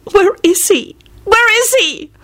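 very high, plaintive wail; bawling. A narrator's reading. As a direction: bawl the question in a high voice. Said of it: "she bawled".

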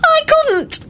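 very high tearful cry; anguished high-pitched insistence; fierce. Acted. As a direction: squeak it out insistently. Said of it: "Solange said fiercely".

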